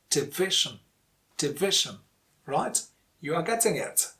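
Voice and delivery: normal talking voice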